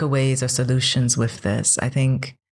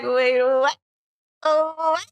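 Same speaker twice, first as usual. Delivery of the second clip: mock confusion; 13 semitones higher than usual. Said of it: "and I'll be like".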